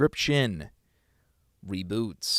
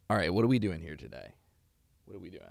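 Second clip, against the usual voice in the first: ordinary talk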